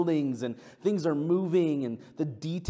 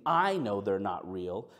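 ordinary delivery

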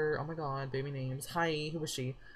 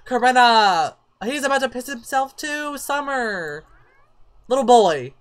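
high, loud, scolding cries; exclaiming at characters; 8 semitones higher, 11 dB louder than usual. Real, not acted. Player calling out misbehaving Sims during the labor, loud exclamation. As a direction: call them out loudly by name.

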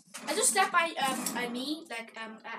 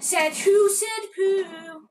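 slow put-on voice; 2 semitones higher, 5 dB louder, much slower than usual.

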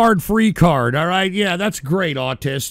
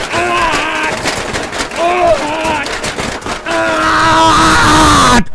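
angry scream; 6 semitones higher, 5 dB louder, much slower than usual. Acted. Staged rage screams.

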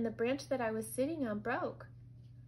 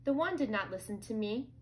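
ordinary delivery, nothing marked

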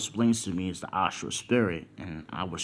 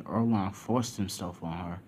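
usual speaking voice

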